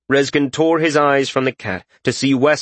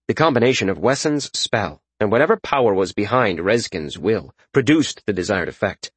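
ordinary delivery

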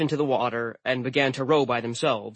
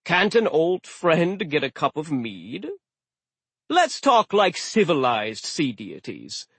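jovial slow sea god; 4 semitones higher than usual. Acted. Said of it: "the god said cheerfully".

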